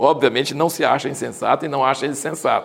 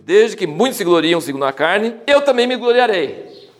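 sarcastic reading; 5 semitones higher, slower than usual. Acted. Reads Paul's sarcastic verses.

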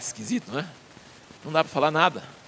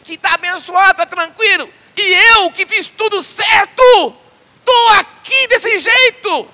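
high, loud, slow crying plea; Job's anguished lament; 9 dB louder, slower than usual. Retold or imitated. Job crying out to his friends about God's silence, high and loud. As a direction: cry out to God demanding an answer. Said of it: "ele fala assim".

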